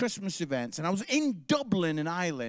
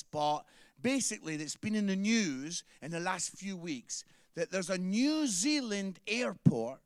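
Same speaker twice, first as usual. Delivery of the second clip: ordinary delivery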